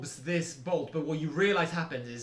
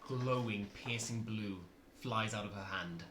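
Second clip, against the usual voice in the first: dramatic narration; 5 semitones lower, 7 dB quieter than usual. A narrator's reading. GM describes spell dramatically.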